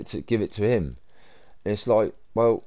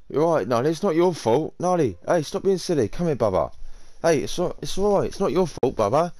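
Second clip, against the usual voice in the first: soothing the dog; 10 semitones higher than usual. Real, not acted. Owner comforting his frightened dog, high coaxing pet voice. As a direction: coax her gently, pitched up like talking to a pet.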